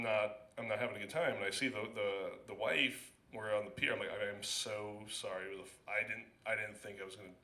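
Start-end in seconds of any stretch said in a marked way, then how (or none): 4.1-7.3 ashamed apology. Retold or imitated.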